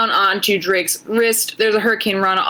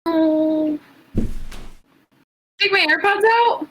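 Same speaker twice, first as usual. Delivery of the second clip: high, slow mock whine; high mock dismay; 9 semitones higher, much slower than usual. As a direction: drag it out high and whiny.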